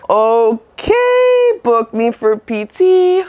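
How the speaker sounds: high, sing-out falsetto okay; hammed-up cheery compliance; mock-eager agreement. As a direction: chirp it in a slow, exaggerated high voice. Acted eager reply to doctor.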